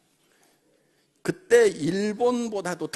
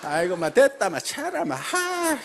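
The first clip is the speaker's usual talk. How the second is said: Gyeongsang dialect; 5 semitones higher than usual. Retold or imitated. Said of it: "전라도 말, 경상도 말 막, 막 섞여 있거든요".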